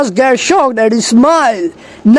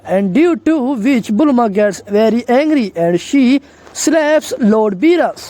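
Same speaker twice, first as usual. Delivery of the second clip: plain reading voice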